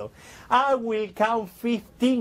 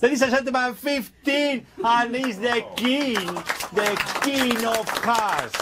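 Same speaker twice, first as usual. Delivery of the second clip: showman's reveal; 5 semitones higher than usual. Real, not acted. Magician's big card reveal.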